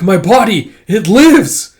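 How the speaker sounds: a creature rejoicing its body lives; gleeful awakening. Acted. Realizing its body lives, triumphant and loud. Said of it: "It's like".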